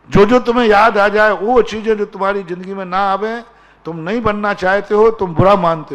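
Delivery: high, loud preaching; loud, high-pitched sermon delivery; forceful exhortation. Real, not acted. Preacher forcefully instructing the congregation.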